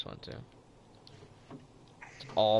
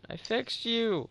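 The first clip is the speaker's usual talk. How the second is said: high slow cry; 13 semitones higher, much slower than usual. Real, not acted. Revives partner in fight.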